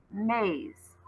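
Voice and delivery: usual speaking voice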